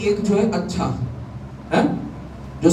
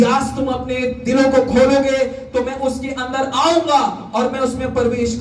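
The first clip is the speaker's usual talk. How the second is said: high-pitched scripture proclamation; preaching; 8 semitones higher than usual. Real, not acted. Preacher quotes God's promise to the congregation, high proclamation.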